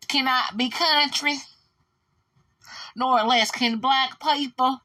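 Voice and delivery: high, drawn-out Southern country drawl. Pretend. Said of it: "This is my [name] accent".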